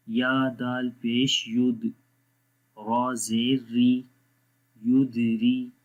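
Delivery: slow, measured recitation on a level pitch; drawn-out, evenly intoned syllables; Quranic spelling drill. Shown as a demonstration. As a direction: chant each letter, vowel and syllable.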